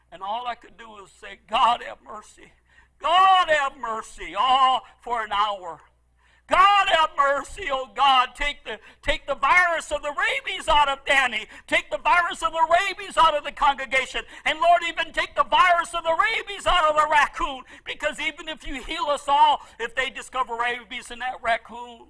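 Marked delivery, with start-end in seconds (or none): 1.5-4.3 beg, the name of God nearly shouted; pleading for mercy. Retold or imitated. 6.5-22.1 fervent pleading prayer. Retold or imitated. Retold hours-long prayer.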